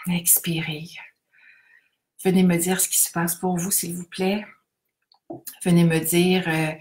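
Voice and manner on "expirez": whispered on the out-breath; whispered exhale cue. Shown as a demonstration. Guide exhaling, whispering the cue. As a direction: breathe the word out. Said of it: "inspirez profondément".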